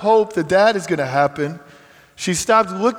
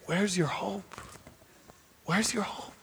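much softer, gentle asking; soft imagined question; 3 semitones higher, 13 dB quieter than usual. Retold or imitated. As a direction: ask it softly, as if leaning in to a grieving woman. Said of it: "It's almost like they're saying".

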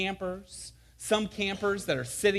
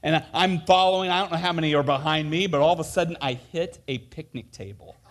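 ordinary delivery

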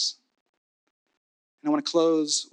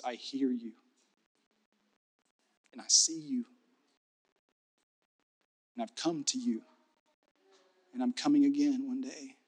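much softer, intimate God voice; tender whisper-soft; 2 semitones lower, 6 dB quieter than usual. Acted. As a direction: say it close and tender. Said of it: "a God who says".

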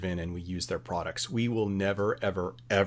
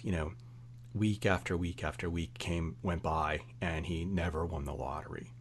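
ordinary talk, nothing marked

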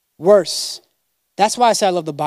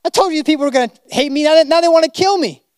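higher, pleading complaint to God; a frustrated Moses griping upward, voice raised; exasperated Moses; 7 semitones higher, 3 dB louder, faster than usual. Retold or imitated. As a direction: whine it out like a man at the end of his rope. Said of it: "I can imagine Moses being like".

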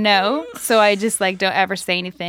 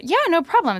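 breezy yeah; 9 semitones higher than usual. Retold or imitated. Agreeing to push wire.

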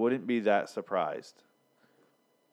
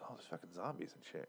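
hushed, much softer aside; reading to himself; 3 semitones lower, 15 dB quieter than usual. Retold or imitated. [name] reading the show's script, under his breath. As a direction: mumble through a script as if skimming it privately.

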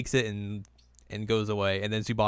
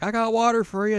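put-on much higher, cute kid voice; high squeaky Shippo voice; 11 semitones higher, 4 dB louder than usual. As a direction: squeak it out like a little fox kid. Retelling anime, voicing Shippo.

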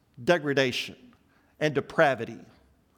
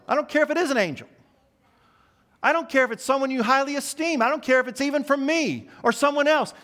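much higher, fast and heated; emphatic Paul; 9 semitones higher, faster than usual. Retold or imitated. Preacher as Paul dismissing even angels, fast and heated. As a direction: fire it off, rising in urgency. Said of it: "he says it so emphatically".

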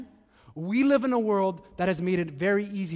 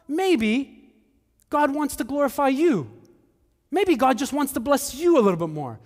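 higher, coaxing devil's voice; tempting devil; 6 semitones higher than usual. Acted. Preacher voicing the devil tempting Jesus, higher and coaxing. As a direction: sweet-talk him like the tempter. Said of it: "the devil will meet Jesus ... to say".

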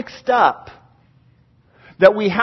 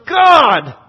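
loud, higher plea to God; raised voice, drawn-out cry; 5 semitones higher, 5 dB louder, much slower than usual. Acted. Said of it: "he cries out again, and he goes".

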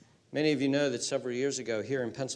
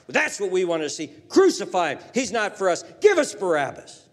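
a hint of a jeering mob, raised and louder; angry crowd; 10 semitones higher, 11 dB louder than usual. Preacher lightly voicing the crowd demanding crucifixion, mock anger. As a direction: lightly voice the disappointed crowd turning on him.

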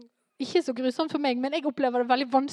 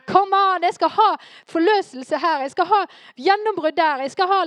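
higher and louder, claiming the breakthrough; bold, demanding prayer; 5 semitones higher, 7 dB louder than usual. Acted. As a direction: throw fireballs from heaven with your voice. Bold prayer from heavenly perspective. (frimodig, krevende bønn, høyere og sterkere)

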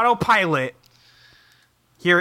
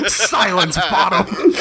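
laughing mock order; 2 semitones higher, 4 dB louder, slower than usual. Real, not acted. Host joins the joke, laughing.

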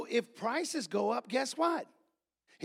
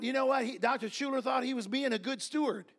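usual speaking voice

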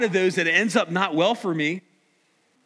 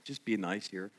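much softer, lower throwaway remark; quiet aside; 9 semitones lower, 12 dB quieter than usual. Real, not acted. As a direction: drop to a murmur for the front row. Quiet aside mid-sermon.

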